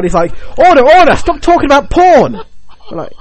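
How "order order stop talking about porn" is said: very high, loud courtroom shout; hammed-up bellow calling the court to order; outraged judge. Judge to rambling podcasters in court, outraged shouting. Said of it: "the guy's just banging his mallet down".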